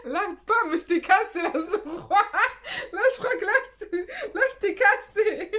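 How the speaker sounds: high, loud words breaking into giggles; real giggling fit, high-pitched; laughing while talking. Real, not acted. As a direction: try to speak through helpless laughter. Helpless giggling after teasing.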